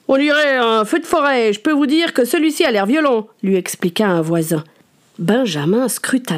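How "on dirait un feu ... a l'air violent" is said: lightly voiced chatty neighbour; a touch higher, gossipy neighbour. A narrator's reading. Neighbour voice in audiobook.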